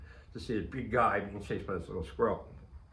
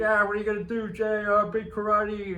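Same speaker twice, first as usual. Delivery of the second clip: mocking taunt; 8 semitones higher, 6 dB louder than usual. Henry teasing Jay as the squirrel charges, mocking jeer. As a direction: rib your buddy about his karate. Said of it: "Now Henry's laughing at me".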